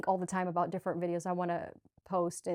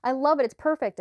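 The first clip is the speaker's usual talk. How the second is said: much higher, louder joyful inner voice; lightly voiced beaming higher self; delighted higher self; 8 semitones higher, 5 dB louder than usual. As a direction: gush like a thrilled higher self who loves the challenge. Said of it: "My higher self was probably like".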